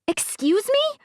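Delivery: put-on high incredulous girl voice; sharp disbelieving question; indignant girl.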